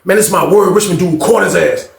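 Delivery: ordinary talk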